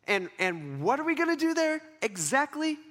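high, hesitant query; wary questioning. Acted. Jesus asking God what happens there, high and wary. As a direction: stammer into a mock-worried question. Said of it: "I would have some questions".

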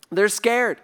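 usual speaking voice

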